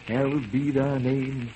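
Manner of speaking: quiet reverent prayer. Acted. Toussaint praying the Lord's Prayer, quiet and reverent. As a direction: pray softly and devoutly.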